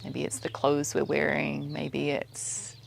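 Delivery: soft, even meditation voice, spaced out with pauses; quiet, unhurried mindfulness cueing; guided meditation. Acted. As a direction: guide their attention gently through the senses. Leads a meditation.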